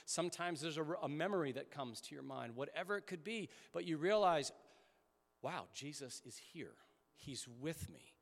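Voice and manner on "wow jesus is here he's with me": quiet awe. Retold or imitated. Awed realization in prayer.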